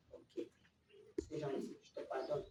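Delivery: maman qui pardonne en murmurant; pardon murmuré. Acted. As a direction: souffle le pardon tout bas. Maman pardonne tout doucement. (maman pardonne à voix très basse)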